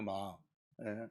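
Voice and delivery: ordinary talk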